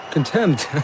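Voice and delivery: usual speaking voice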